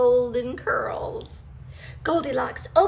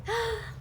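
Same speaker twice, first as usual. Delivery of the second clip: soft gasp; 9 semitones higher, 5 dB quieter than usual. Acted. Settling into bed.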